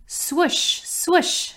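airy high whooshing swoosh sound; swing swoosh. A narrator's reading. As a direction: breathe out a rising swish like a swing.

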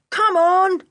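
loud, high call to friends. A narrator's reading. Sooty urging the others over the wall, calling out. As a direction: raise your voice and call them on. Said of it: "called Sooty".

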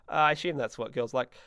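usual speaking voice

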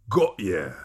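low, gravelly villain voice; deep, gravelly. A narrator's reading. Clive catching Lavender, deep gravelly voice. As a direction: growl it deep in the throat. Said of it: "said a deep, gravelly voice".

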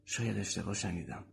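hushed, doubtful aside to oneself; talking to himself. Acted. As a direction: say it quietly to yourself. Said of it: "با خودش گفت".

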